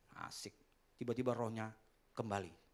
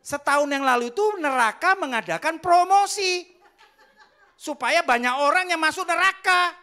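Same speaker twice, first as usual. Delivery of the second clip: loud laughing; 14 dB louder than usual. Real, not acted. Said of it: "preacher punchline, crowd laughing".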